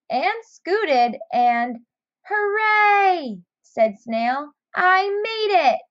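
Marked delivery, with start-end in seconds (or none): 2.3-3.4 high, bright hooray; joyful cheer. Acted. Snail reaches the cabbage. 4.7-5.8 high, happy exclamation; joyful triumph. Acted. Snail reaches the cabbage.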